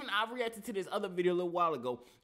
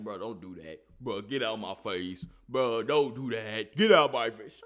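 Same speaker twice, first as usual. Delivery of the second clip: lower, slow tough-guy warning; a hint of a tough guy squaring up; lightly voiced macho threat; mock tough guy; 9 semitones lower, 5 dB louder than usual. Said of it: "acting all tough".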